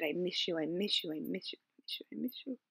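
softly whispered, near tears; real grief breaking through a whisper; tearful whisper. Retold or imitated. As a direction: whisper it again and again, grieving. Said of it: "I said".

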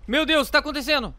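fast, high frightened cry; alarmed gamer shouting at the screen; panicked. Real, not acted. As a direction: blurt it out in real panic. Panic during earthquake in game.

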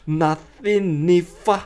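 "if I" said as "ファ"; linked F. Shown as a demonstration. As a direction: glide F into I. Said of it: "ファですね".

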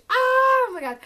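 high thrilled shriek of oh my god; excited squeal. Real, not acted. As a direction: squeal with delight at the packages. Opening long-awaited book packages.

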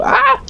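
sudden high, loud yelp; startled yelp. Real, not acted. Gamer caught off guard in game, startled yelp. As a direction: yelp as if jumped on.

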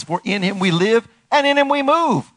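high, strong preaching voice; proclaimed scripture. Real, not acted. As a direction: ring the verse out to the congregation.

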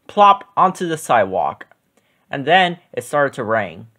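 usual speaking voice

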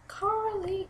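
lightly acted call to someone out of sight. Babysitter summons Carly to play with Jane, calling out. Babysitter calls a girl over.

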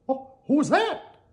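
high, startled cartoon question; curious Mickey. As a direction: ask in a high surprised voice. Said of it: "asked Mickey".

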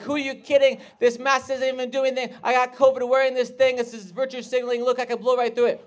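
high, loud hammed-up ranting; exaggerated furious rant; mock Republican rage. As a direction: rant like an outraged anti-masker. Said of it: "I went full Republican rage".

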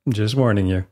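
usual speaking voice

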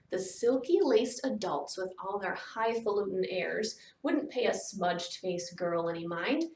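ordinary delivery

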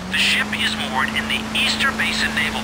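briefing through a radio earpiece; thin, distant transmitted voice. Acted. Handler briefing his agent on the ship, over earpiece.

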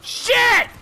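sudden high-pitched curse; real yelp of dismay; startled curse. Real, not acted. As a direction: blurt it out, caught off guard. Startled mid-game by enemy.

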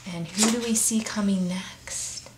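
a secretive hushed aside; hushed storyteller's whisper. Real, not acted. Aside to the listening child.

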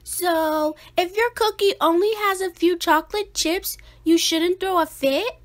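high, fast sister voice; teasing little sister. A narrator's reading. Sister turns the rule back on Melvin, high teasing voice. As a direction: needle her brother, high and quick.

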